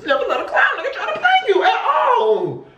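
high, laughing shout; gleeful high-pitched yell; hyped quote. Retold or imitated. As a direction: crow the rule back at them, cracking up. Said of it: "You said".

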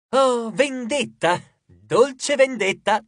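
slow, high theatrical relish; hammed-up gloating cry. A narrator's reading. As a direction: savour the revenge, drawn out. Dwarf gloats over sweet revenge.

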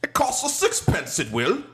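high-pitched Cockney caricature, dropping on each tag ending; mock Cockney. Mocking the Cockney accent the agent didn't have, mock Cockney. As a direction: put on a hammed-up Cockney, starting high. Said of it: "that whole Cockneyed accent".

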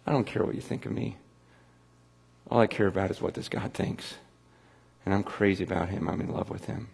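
soft, near-whispered confession of love; hushed devotion. Retold or imitated. Devoted woman whispering her love for God, hushed. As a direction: breathe it quietly, lost in adoration. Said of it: "like that woman that just".

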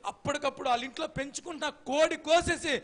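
usual speaking voice

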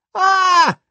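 loud high startled scream. Acted. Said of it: "the kids screamed again".

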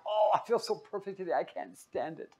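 playful parody of a smug riser; mock smug morning voice. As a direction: gush about being perfect, hammed-up. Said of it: "Wake up in the morning and go".

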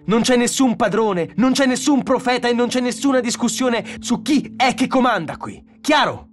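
high, mocking anger rising to a shout; furious, escalating tirade; sarcasm building to roaring. A narrator's reading. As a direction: start scornful, end bellowing. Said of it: "Ora stava ruggendo".

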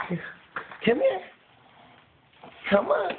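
very high squeaky come here; squeaky dog call. Real, not acted. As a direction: call the pup in a tiny high voice. Calling the dog over.

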